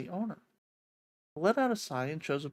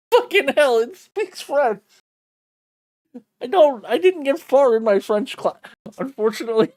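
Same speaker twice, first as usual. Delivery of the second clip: excited outburst; 8 semitones higher, 13 dB louder than usual. Real, not acted. Streamer startled by bird's French.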